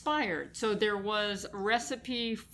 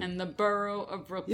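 put-on Brooklyn accent. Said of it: "You have to do it in a Brooklyn accent".

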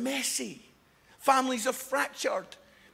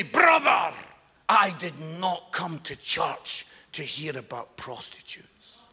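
imitated stern disapproval; 7 semitones lower, slower than usual. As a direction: mimic a solemnly offended churchgoer.

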